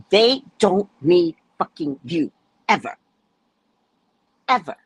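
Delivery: re-enacted stern dressing-down; retold telling-off. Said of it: "I turned into the Hulk".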